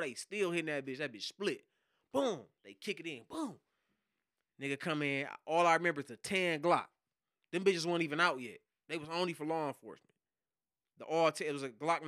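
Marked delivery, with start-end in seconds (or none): none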